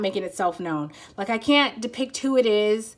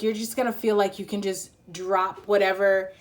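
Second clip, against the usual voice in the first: ordinary talk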